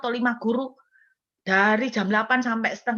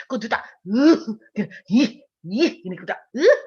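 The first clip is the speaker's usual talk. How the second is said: exasperated grunts; 4 dB louder than usual. Shown as a demonstration. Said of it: "gitu kan".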